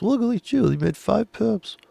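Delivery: high, sneering mock praise. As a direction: patronize the tiny win. Mocking a small win.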